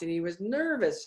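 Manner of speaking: ordinary delivery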